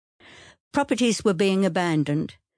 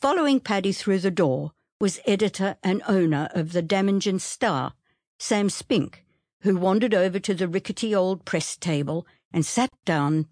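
plain reading voice

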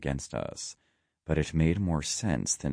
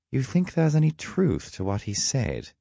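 high-pitched, probing character voice; sidekick's pitched-up question; questioning companion; 9 semitones higher than usual. A narrator's reading. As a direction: ask it high, as the uneasy sidekick. Loki voiced, questioning his companion.

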